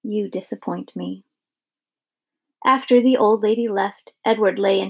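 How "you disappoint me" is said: soft, hushed reproach; whispered. A narrator's reading. Grandmother leans close to the toy rabbit, whispering. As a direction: say it very softly, close to the ear. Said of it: "She whispered".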